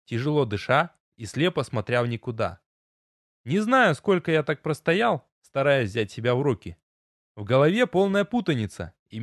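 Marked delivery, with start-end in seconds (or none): none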